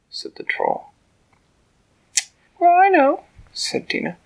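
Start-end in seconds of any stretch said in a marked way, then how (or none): 2.6-3.2 high, loud little ant; tiny ant voice. A narrator's reading. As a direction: speak up boldly as the tiny ant.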